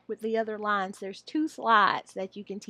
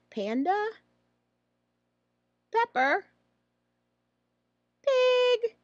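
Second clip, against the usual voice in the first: vocabulary read; 11 semitones higher, slower than usual. Shown as a demonstration. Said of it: "teach all three".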